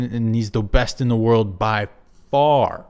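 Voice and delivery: ordinary talk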